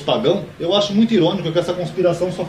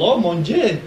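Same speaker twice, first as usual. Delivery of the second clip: higher mock French accent, Dieu as "djiê"; imitating a Frenchman; 4 semitones higher, much slower than usual. Teacher showing what a Frenchman says, in imitation French accent. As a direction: quote it like a Frenchman. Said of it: "o francês, ele fala".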